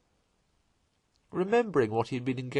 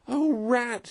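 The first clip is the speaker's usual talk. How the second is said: high, louder cry of relief; relieved cry; 12 semitones higher, 4 dB louder than usual. A narrator's reading. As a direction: call out to your rescuer at last. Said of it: "he cried".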